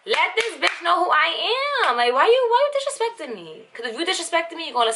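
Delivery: high, indignant demand; rising outrage at being disrespected; angry outrage. Retold or imitated. Girlfriend confronting cheating boyfriend, outraged.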